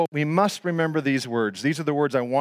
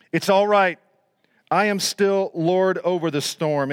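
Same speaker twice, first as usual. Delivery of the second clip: raised, emphatic voice of authority; bold declaration; 4 semitones higher than usual. Acted. Jesus as Lord over the storm, bold proclamation.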